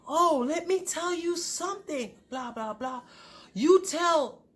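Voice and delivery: high-pitched imitation of an irate woman; mocking retold rant. Ex ranting on the phone to husband, mock indignant. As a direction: mimic the rival's sassy telling-off.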